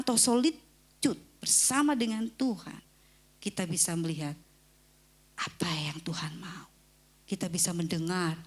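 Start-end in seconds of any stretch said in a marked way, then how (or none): 5.4-6.7 soft unvoiced whisper. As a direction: breathe it like a secret. Repeated whispered phrase. (bisikan lembut)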